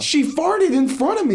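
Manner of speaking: high, outraged squawk; indignant protest. Real, not acted. Storyteller defending herself to doubting friends, indignant protest.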